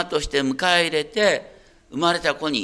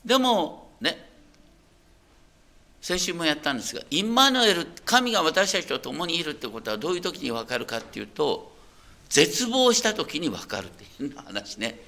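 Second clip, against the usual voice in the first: usual speaking voice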